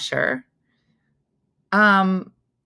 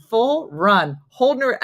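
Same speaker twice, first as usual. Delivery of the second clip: normal talking voice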